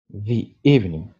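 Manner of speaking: "The" said as "thee" before "evening"; the before a vowel. Shown as a demonstration. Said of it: "on va prononcer the". ("The" prononcé "thi" devant voyelle)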